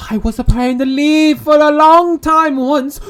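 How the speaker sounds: ordinary delivery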